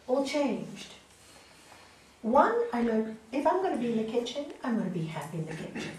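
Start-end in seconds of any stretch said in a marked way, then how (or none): none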